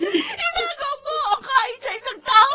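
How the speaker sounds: sobbing furious shouts; crying rage. Acted. Grieving mother screams at her son's lover, crying. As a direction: scream the blame through tears. Mother rages through tears.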